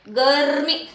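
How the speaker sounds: गर्मी as drawn-out "garrr-mee"; long trill in गर्मी before "mee"; correct rolled r. Shown as a demonstration. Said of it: "जो व्यंजन के पहले".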